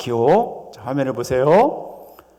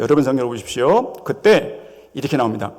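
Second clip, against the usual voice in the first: ordinary delivery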